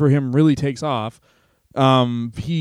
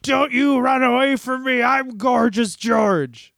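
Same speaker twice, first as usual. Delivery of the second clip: higher, slow put-on voice as the wrestler; menacing Gorgeous George; 8 semitones higher, slower than usual. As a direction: play the entitled star who threatens her. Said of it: "and he said".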